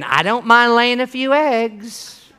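slow, drawn-out mimicry of the chicken; reluctant chicken. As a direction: stretch it out as the half-committed chicken. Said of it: "We're like the chicken".

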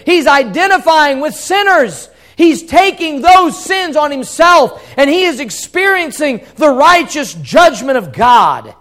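loud, high-pitched sermon delivery; impassioned preacher at peak volume; full-force preaching. Real, not acted. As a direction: drive it home to the congregation at full voice.

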